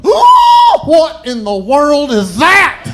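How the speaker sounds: high shrieking alarm, slow and loud; shocked shriek. Retold or imitated. Driver glancing in the rear view mirror, shrieking in alarm. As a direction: scream it like the van came from nowhere. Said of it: "and gone".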